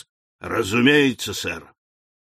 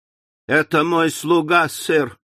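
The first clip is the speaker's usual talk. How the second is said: ordinary delivery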